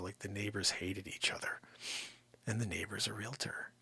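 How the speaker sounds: hushed, breathy confession; whisper. Real, not acted. Realtor confiding a horror story to viewers, whispered. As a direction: lean in and whisper it.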